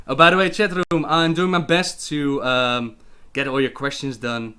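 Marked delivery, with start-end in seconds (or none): none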